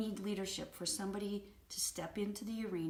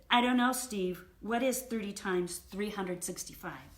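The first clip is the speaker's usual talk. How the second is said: loud, exaggerated not-knowing; sarcastic; 6 dB louder than usual. Wife answering husband's maths question, sarcastically. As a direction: throw the question back with heavy sarcasm. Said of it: "in the most sarcastic voice".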